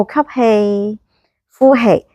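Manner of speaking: slow inhale call, lifted exhale; slow breath counting. Shown as a demonstration. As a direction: lead the breath slowly. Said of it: "呼到幾深". (慢慢拉長嘅呼吸口令)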